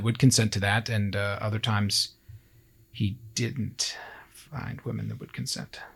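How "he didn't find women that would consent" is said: whispered, grave aside; hushed whisper. Real, not acted. True crime host whispering the dark detail. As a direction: drop to a whisper for the grim part.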